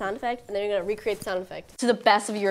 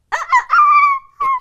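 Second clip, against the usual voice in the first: loud, very high dolphin squeak; dolphin squeal; 12 dB louder than usual. Acted. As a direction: belt out a high dolphin call. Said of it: "warm up the vocal cords".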